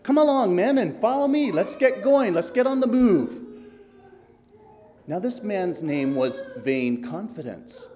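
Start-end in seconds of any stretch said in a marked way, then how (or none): 0.0-3.5 high, loud, cocky leader voice; a self-assured stranger urging them on; swaggering know-it-all beckoning the travellers; boastful guide. Acted. Vain Confidence boasts, leads them.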